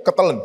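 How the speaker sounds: a short stifled crying gasp; choked-back sob gasp. Shown as a demonstration. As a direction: demo a sob caught in the throat. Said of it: "Lagi nangis".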